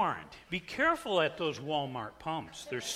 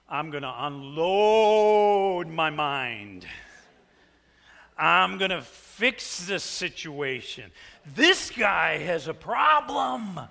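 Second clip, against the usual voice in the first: rising rage; 5 dB louder, slower than usual. Retold or imitated. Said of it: "I can feel the seed of rage".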